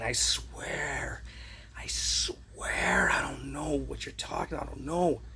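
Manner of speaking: hushed, breathy "I swear, I swear"; a guilty whispered denial; whispered pleading innocence; mock whisper. Quoting lying pleading spirits.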